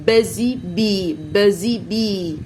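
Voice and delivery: بزي بي drawn out slowly with buzzing z; slow model phrase. Shown as a demonstration. Teacher to children, slowly buzzing بزي بي. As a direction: stretch بزي بي, buzz the z. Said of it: "انطقها ز".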